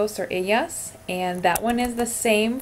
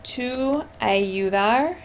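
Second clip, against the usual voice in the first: slow read; 3 semitones higher, much slower than usual. Shown as a demonstration. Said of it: "reads the exercise prompt".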